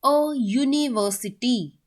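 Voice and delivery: अ यूनिवर्सिटी slowly, syllable by syllable; pronunciation demo. Shown as a demonstration. Teacher has students check pronunciation, यूनिवर्सिटी slowly drawn out. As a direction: stretch out यूनिवर्सिटी from its y sound. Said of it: "इसकी pronunciation देखोगे".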